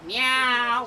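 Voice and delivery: loud, drawn-out meow; witch speaking cat. Acted. Witch bargaining with the cat, loud meow. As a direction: meow it as an offer. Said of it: "so she could speak cat".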